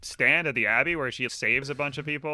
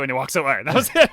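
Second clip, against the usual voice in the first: ordinary talk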